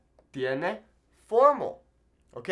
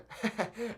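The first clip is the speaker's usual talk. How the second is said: short laugh; 6 semitones higher, 6 dB quieter than usual. Real, not acted. Joking with friends.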